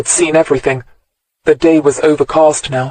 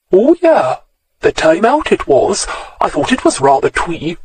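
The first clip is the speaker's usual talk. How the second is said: put-on sing-song Welsh accent, pitched higher; thick Welsh accent; 4 semitones higher than usual. A narrator's reading. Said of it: "spoke for the first time in a thick Welsh accent".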